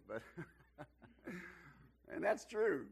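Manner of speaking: usual speaking voice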